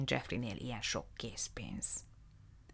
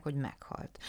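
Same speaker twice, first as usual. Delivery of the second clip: usual speaking voice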